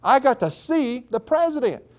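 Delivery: put-on high-pitched, joyful young woman's report; overexcited daughter. Daughter telling dad about seeing the president, overexcited. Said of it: "how joyful she was and overexcited".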